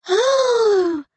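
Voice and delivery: high, loud gasp of wonder; be dazzled by the gown; wide-eyed awe. Acted. Assistant dazzled by the scholar's gown, gasping loud. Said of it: "wide-eyed in the mirror".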